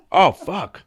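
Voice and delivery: reenacted "oh no" moment, pushed up and out; retold alarmed realisation. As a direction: act out a sudden horrible discovery. Acts out discovering the bugs.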